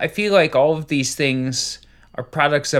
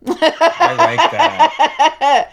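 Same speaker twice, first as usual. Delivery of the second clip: laughing; 7 dB louder, much slower than usual. Real, not acted. Laughing approval.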